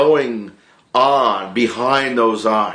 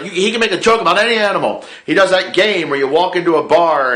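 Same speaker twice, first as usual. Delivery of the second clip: Andrew Lincoln; 6 semitones higher than usual. Retold or imitated. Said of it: "he was like".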